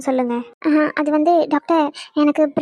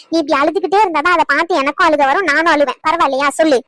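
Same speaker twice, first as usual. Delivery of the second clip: ordinary delivery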